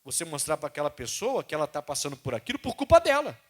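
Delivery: ordinary talk, nothing marked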